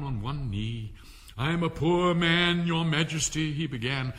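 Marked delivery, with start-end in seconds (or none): 1.4-3.5 slow, higher, louder pleading; miserable plea. Acted. Kneeling Hatter pleads with the king, slow and higher. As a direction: beg on one knee, slowly. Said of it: "miserable Hatter".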